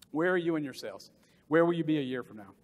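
normal talking voice

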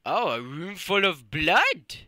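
ordinary delivery

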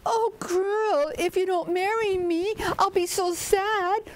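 high, mock-weepy wail; tearful snake. Snake begs the girl to marry him, high mock-tearful pleading. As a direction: plead as if about to bawl. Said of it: "And he cried and he cried".

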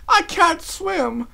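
very high, loud, drawn-out wail; panicked cry. A narrator's reading. Rarity struggling in the river, crying out in panic. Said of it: "Rarity cried".

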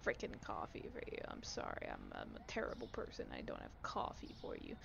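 hushed guilty muttering; soft sheepish whisper; whispered grumble. Real, not acted. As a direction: grumble it under your breath.

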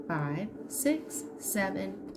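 ordinary delivery, nothing marked

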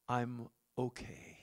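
breathy hushed whisper; whispered calm. Pretend. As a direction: whisper it like nothing can touch you. Preacher voices inner calm amid chaos.

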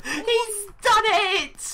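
very high, slow celebratory cry; drawn-out cheer. Real, not acted. Player celebrating an extra point, high drawn-out cheer.

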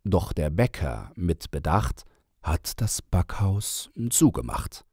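plain reading voice

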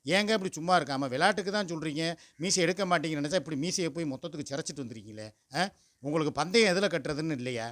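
ordinary talk

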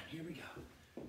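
normal talking voice

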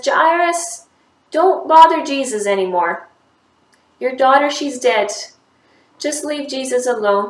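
high, loud urgent yell; shouting. Acted. Messenger shouting bad news to Jairus. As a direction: call out the bad news from afar. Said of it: "he starts shouting already".

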